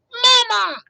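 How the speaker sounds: put-on very high, loud baby-bird squeak; tiny chick crying for its mama; gosling peep. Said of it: "peeped the gosling".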